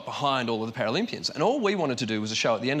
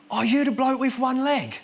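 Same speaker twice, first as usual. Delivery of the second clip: higher, louder imitation of a London cabbie; cabbie's question; 12 semitones higher, 5 dB louder than usual. Comic imitating a cabbie recognising him, surprised blurt. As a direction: blurt it out like a surprised taxi driver. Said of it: "kinda look in the mirror and go".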